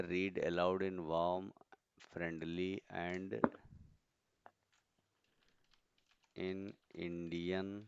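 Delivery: drawn-out words with long pauses; slow dictation. Real, not acted. Teacher entering a voice instruction, slow dictation. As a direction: read it slowly as you type. Reads out instruction while typing it.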